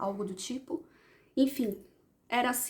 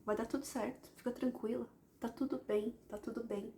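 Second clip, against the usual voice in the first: soft, quieter, calming words; soothing; 8 dB quieter than usual. Retold or imitated. A spirit comforts a sad woman, soft and soothing. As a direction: hush and comfort her gently. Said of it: "que ele me falava".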